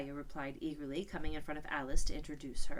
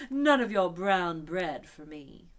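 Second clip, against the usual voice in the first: slow, loud disdainful refusal; scornful unicorn; 10 dB louder, slower than usual. A narrator's reading. Unicorn refusing brown bread, slow and scornful. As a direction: turn your nose up at it.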